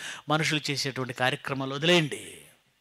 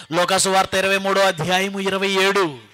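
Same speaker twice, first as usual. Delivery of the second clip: loud, high declamation; preached scripture reading; 5 semitones higher, 9 dB louder than usual. Acted. Preacher reading Luke to the congregation, high declaiming voice. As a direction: proclaim the verse to the crowd, stretching key words. Reads out the Bible passage.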